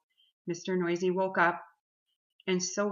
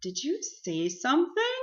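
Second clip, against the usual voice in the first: higher, innocent rising question; pretending not to notice; 7 semitones higher, 3 dB louder than usual. A narrator's reading. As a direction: ask as if you heard nothing. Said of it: "pretended not to notice".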